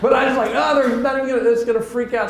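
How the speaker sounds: high-pitched comic fretting; mock panic. Voicing his own nervous dread.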